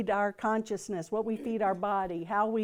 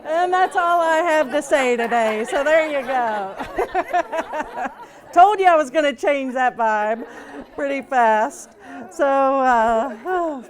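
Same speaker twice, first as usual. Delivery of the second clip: louder, talking through real laughter; laughing; 3 semitones higher, 8 dB louder than usual. Real, not acted. Speaker to the group after the joke, laughing loudly. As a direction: still cracking up at his own punchline.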